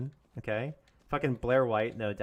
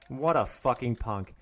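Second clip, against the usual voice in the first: ordinary talk, nothing marked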